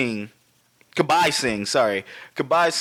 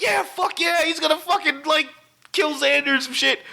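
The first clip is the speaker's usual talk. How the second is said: high, excited fan cheering; re-enacted movie-watching hype; really hyped; 12 semitones higher than usual. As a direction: cheer on your hero, pitch way up. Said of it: "We were fucking really hyped".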